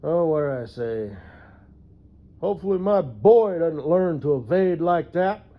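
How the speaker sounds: loud sarcastic jab for the shop; said loudly. Retold or imitated. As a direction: call it out for the room to hear. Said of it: "So I said real loud".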